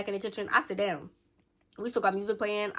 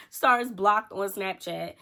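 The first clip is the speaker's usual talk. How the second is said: slow, louder, deliberate restatement; slow emphatic repeat; 5 dB louder, much slower than usual. Shown as a demonstration. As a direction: spell it out slowly, every word weighted. Repeats reveal slowly for effect.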